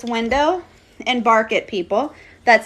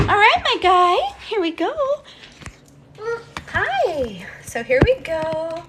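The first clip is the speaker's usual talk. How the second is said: pet talk; 5 semitones higher than usual. Real, not acted. Greeting the bird.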